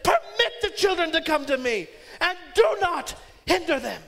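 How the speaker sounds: Jesus rebuking the disciples, loud and indignant; indignant command. Retold or imitated. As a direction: demand it with fire. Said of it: "He said".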